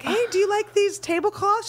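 high, cheery bride's question; bright, eager question. Retold or imitated. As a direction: chirp it hopefully. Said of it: "I'd be like".

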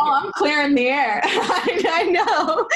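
high, laughing reply; high, giggly agreement; laughing along. Real, not acted. Laughing at interviewer's quip.

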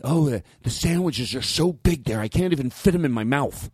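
mock gushing diner. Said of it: "when people go".

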